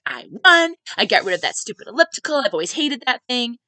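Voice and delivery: loud, high crowing, retold; triumphant mock gloat; victorious. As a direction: imitate a gloating winner, punched out loud. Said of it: "laughing this victorious laugh, like".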